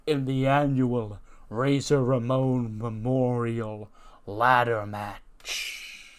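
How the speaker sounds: very slow, stretched roll call; grand announcement. Acted. Boss listing entrants for the ladder match, announcing grandly. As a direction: build suspense name by name, stretching the final words.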